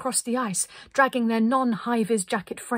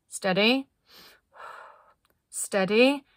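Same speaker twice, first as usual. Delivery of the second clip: drawn-out, rising holds; cautious countdown; 3 dB louder, slower than usual. A narrator's reading. Linda readying the group at the edge, suspenseful. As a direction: hold them back with a rising word.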